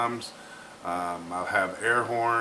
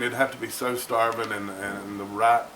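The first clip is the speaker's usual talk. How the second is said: ordinary delivery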